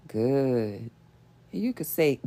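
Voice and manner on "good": low, drawn-out "good". Shown as a demonstration. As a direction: drop good down low and stretch it.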